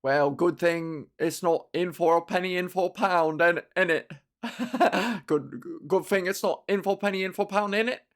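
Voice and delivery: lightly voiced cockney bloke; teasing cockney mate; mock British voice. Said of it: "And he's like".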